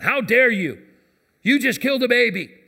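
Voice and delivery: loud, high, accusing outburst; harsh rebuke. Pretend. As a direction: condemn her in a mock self-righteous shout. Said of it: "like some of you might feel I should respond".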